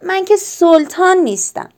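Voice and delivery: put-on a frustrated child yelling about being teased; angry shout. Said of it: "با عصبانیت فریاد زد".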